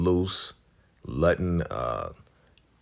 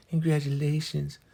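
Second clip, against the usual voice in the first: very high, put-on congratulatory voice; Joan's congratulations; 10 semitones higher than usual. Demonstrating how the wife's friend talks, high mimicry. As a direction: mimic her in a sustained high pitch. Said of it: "You know how Joan told".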